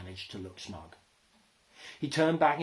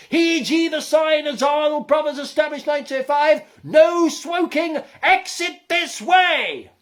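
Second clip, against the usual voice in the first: furious proclamation; 16 dB louder than usual. Acted. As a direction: thunder each sign at the intruder. Gerda rages at the human.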